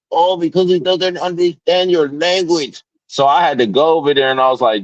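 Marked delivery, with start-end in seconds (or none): 0.1-2.8 high mocking clerk voice; retelling the cashier's words in a high mock voice. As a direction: mimic his excuse up high. Repeating clerk's line mockingly.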